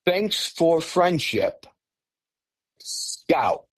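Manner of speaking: slow, spaced-out monotone, trailing into a hush; monotone mind-speak voice. Retold or imitated. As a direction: intone each word slowly, dropping to whisper. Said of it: "very monotone voice say".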